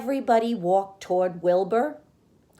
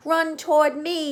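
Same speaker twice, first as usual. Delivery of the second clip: higher gander yell; 9 semitones higher, 4 dB louder than usual. A narrator's reading. The gander yelling to Wilbur. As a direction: call him over. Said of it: "yelled the gander".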